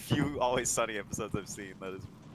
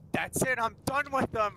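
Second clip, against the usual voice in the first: higher, put-on voice of someone snapping; fed-up declaration; 10 semitones higher than usual. Character fed up with the neighbors, high fed-up voice. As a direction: announce you've had enough. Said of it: "And he's like".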